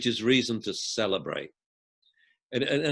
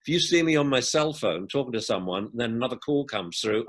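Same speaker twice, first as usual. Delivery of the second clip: ordinary delivery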